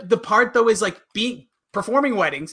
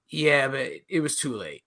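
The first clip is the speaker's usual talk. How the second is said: low deadpan voice; 7 semitones lower, 7 dB quieter than usual. Retold or imitated. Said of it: "Daryl's flat replies".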